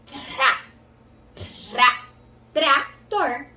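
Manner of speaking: "tra" with a strong trill, then split "trator"; T plus rolled R. Shown as a demonstration. Teacher blending T into rá, rolled R. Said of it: "e o rá".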